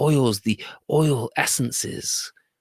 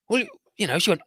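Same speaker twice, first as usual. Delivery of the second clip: usual speaking voice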